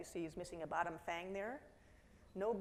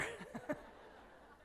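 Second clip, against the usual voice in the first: breathless unvoiced chuckle; a quick knowing laugh at the photo; airy high laugh; 13 semitones higher, 6 dB quieter than usual. Real, not acted. Speaker laughing at the dog photo with the audience.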